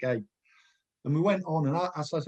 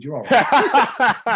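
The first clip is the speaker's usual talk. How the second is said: laughing; 8 semitones higher, 10 dB louder, much slower than usual. Real, not acted. Recalling a teammate's funny line.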